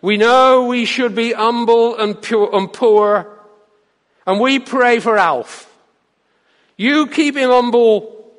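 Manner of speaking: loud and slow mock pulpit prayer; hammed-up preacher boom. A narrator's reading. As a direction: boom it like a deacon praying over his pastor. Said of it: "boomed Alec".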